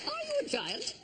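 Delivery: a grand lady's high, gracious hello. Acted. Greeting a guest at a state reception, high lady voice. Royal couple sketch, high voice.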